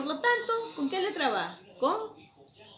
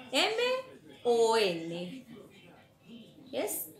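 ordinary delivery

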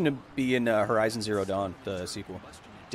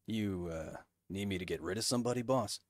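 low soldier henchman; 5 semitones lower, 11 dB quieter than usual. Retold or imitated. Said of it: "I'm still a tool".

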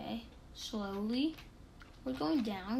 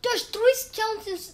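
high, slow alarmed cry; 11 semitones higher, 4 dB louder than usual. Real, not acted. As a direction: spot danger and cry out. Sees enemies.

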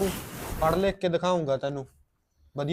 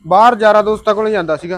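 loud, higher-pitched defensive answer; defensive retort; 6 semitones higher, 13 dB louder than usual. Acted. Brother stopped on his way out, answering back defensively. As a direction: answer back loudly, voice raised. Questioned about going to friends.

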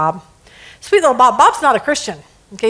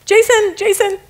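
calling out; 8 semitones higher than usual. Acted. Employee calling the boss.

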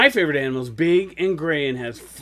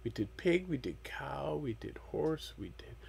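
whispering; 4 semitones lower, 23 dB quieter than usual. Real, not acted. Whispered aside to child.

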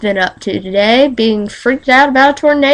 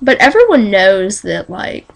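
ordinary talk

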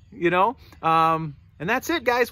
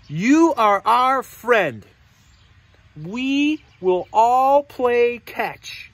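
slow, emphatic declaration; piggy character; 3 semitones higher, 5 dB louder, much slower than usual. Acted. Piggy reassures snake friend, slow emphatic character voice. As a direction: proclaim it word by word, stretched out. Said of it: "And the piggy says".

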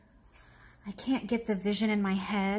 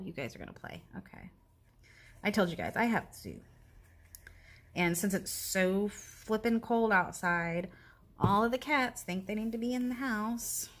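normal talking voice

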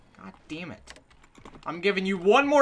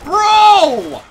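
loud, high, stretched yell; horrified yelling at the wrecker; outraged shout; 9 semitones higher, 13 dB louder than usual. Real, not acted. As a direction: scream in disbelief. Yelling at NPC.

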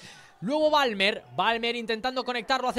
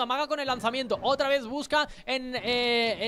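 ordinary talk

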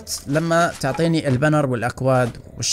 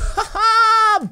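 high laugh; 4 dB louder than usual. Real, not acted. Laughs right after the shock.

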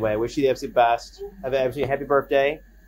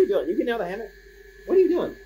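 squeaky, high surprised question to the pet; high pet-talk voice; 9 semitones higher, much faster than usual. Real, not acted. Surprised at the pet leaving the hammock, high pet talk.

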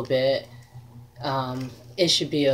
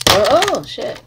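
startled; 6 semitones higher, 6 dB louder than usual. Real, not acted. Drops something mid-show.

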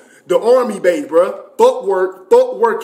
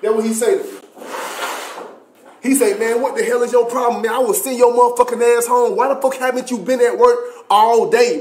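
high, raised mock-angry sergeant; 6 semitones higher than usual. First Sergeant Kane scolding private [name] in office, angry. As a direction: chew him out like a mean boss. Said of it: "he start talking to me".

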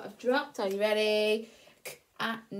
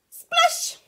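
very high, loud Splash; shouted splash; 11 dB louder than usual. Shown as a demonstration. Teacher showing the child how to read Splash, loud high shout. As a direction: shout Splash with the exclamation mark. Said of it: "so we have to shout".

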